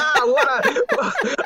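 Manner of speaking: a hammed-up whine of dismay; mock dismay. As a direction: drag it out in comic despair. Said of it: "I was like".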